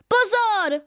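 high, loud frightened shriek; a sudden high scream of alarm. A narrator's reading. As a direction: yelp as the phone slips. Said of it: "zaječí Linda".

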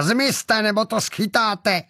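high-pitched yell without much volume; roared threat. A narrator's reading. Gunman yelling at people blocking his target, threatening roar. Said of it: "zařval Čepelka".

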